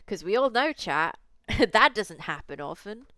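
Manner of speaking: laughing through the words. Real, not acted. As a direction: chuckle while talking to chat. Laughing aside to chat.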